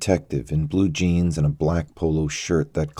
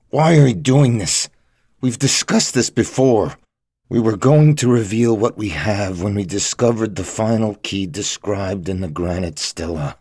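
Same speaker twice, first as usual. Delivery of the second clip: upset protest; 3 semitones higher, 4 dB louder than usual. Acted. Hostage pleads, arguing with captor.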